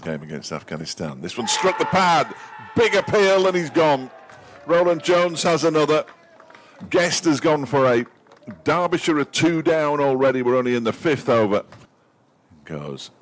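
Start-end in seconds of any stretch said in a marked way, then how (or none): 1.4-11.6 high, loud shouting at the wicket; excited shout. Real, not acted. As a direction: bellow the appeal, thrilled. Second wicket falls.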